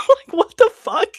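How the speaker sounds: high, loud laughing disbelief; cracking up in disbelief; laughing shock. Real, not acted. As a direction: burst out laughing at it.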